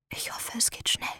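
resigned breathy whisper. Acted. Condemned woman before hanging.

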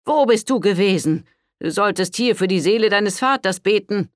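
high, loud, stern rebuke of a priest. A narrator's reading. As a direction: scold her like a severe father confessor. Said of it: "mit strengem Blick". (strenger Pater, tadelnd)

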